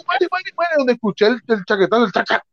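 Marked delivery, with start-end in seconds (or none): 0.1-0.7 high, hurried pleading; panicked surrender. Retold or imitated. Surrendering to the cop with shotgun, mock panic. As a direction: blurt it high, giving up fast.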